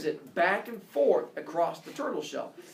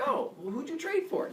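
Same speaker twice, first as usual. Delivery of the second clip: high Hermes voice; 11 semitones higher than usual. Retold or imitated.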